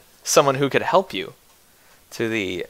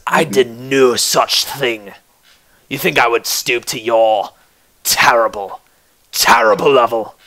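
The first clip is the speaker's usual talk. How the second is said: contemptuous blacksmith; 3 semitones lower than usual. Acted. Rival smith answers with contempt.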